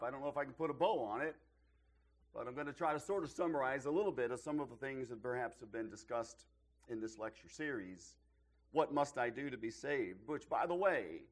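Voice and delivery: ordinary talk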